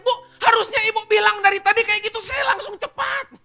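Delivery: loud, high, frustrated pleading; fed-up staffer complaining; exasperated inner protest. Retold or imitated. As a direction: vent it at the boss in your head.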